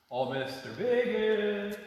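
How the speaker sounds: high, loud call across the church; sing-song loud calling. Real, not acted. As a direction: call out to find him. Calling out for Mister Biggis.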